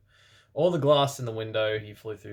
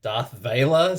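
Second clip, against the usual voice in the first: evil raspy voice; 7 dB louder, slower than usual. A narrator's reading. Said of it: "said evilly in his raspy voice".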